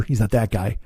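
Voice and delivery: ordinary talk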